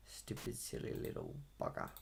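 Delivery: whispered low under the breath; annoyed half-whispered swearing; muttered curse. Real, not acted. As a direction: grumble it to yourself, barely voiced. Muttering at a stubborn track.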